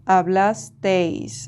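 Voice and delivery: Hablasteis drawn out very slowly; slow model. Shown as a demonstration. Teacher writes the vosotros form, saying it slowly. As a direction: stretch out every syllable of Hablasteis. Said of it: "we rewrite for vosotros".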